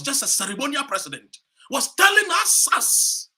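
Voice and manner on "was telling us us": much higher and louder, near scream; outraged shout. Real, not acted. Speaker denouncing Akufo-Addo's father to the crowd, outraged shout. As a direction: explode on it. Rally speech peak, shout.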